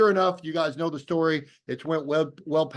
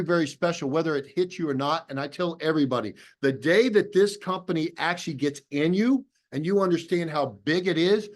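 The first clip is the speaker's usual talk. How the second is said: ordinary talk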